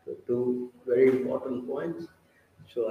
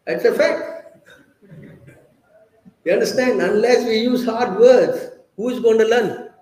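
emphatic loud insistence; 7 semitones higher, 8 dB louder than usual. Real, not acted. Insisting hard words are needed.

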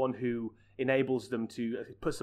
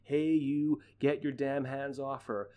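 George; 2 semitones higher, slower than usual. Retold or imitated. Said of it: "George opens the car door and goes".

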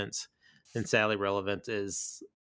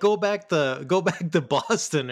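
mock angry heckle; 8 semitones higher, 8 dB louder than usual. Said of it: "they're like".

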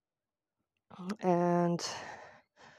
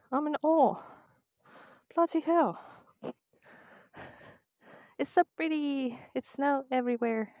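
sarcastic awe; 9 semitones higher than usual. Real, not acted. Sarcastic praise reaching the seaside.

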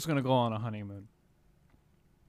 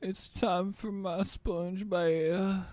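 put-on old man voice; 5 semitones higher, much slower than usual. Playing old man character.

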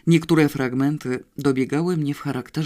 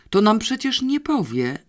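higher-pitched objection, mildly exclaimed; calling out; 8 semitones higher than usual. A narrator's reading. One kidnapper objecting to the others, calling out. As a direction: call it out as an objection. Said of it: "zawołał któryś".

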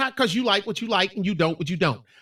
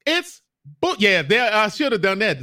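ordinary talk, nothing marked

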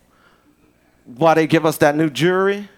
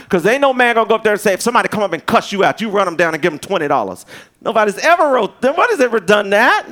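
fast, loud, higher preacher burst; fired-up preaching; 4 semitones higher, 3 dB louder, faster than usual. Acted. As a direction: rattle it off with rising fire. Preacher fires up.

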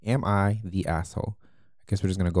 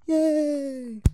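sarcastic yay. Real, not acted. Sarcastic cheer.

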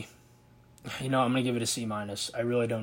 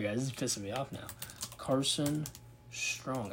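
ordinary delivery

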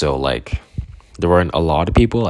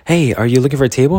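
quick, chirpy waitress; 7 semitones higher, 5 dB louder, faster than usual. Retold or imitated. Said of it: "And she was like".

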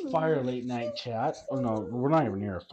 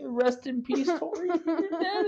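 mock eulogy; 3 dB louder than usual. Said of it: "put it up and say".

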